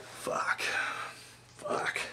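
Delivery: breathless whispered swearing; whispered curse. Retold or imitated. Fan whispering curses at the betrayal on screen. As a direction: mouth the curse under your breath in shock. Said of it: "And I was like".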